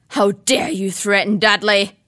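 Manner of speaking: loud roaring fury. Acted. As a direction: roar with rage. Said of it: "roared Uncle Vernon".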